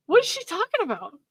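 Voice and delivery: a high, baffled what is she talking about. Real, not acted. Reading his ex's texts, high and baffled aloud. Reacting to the text.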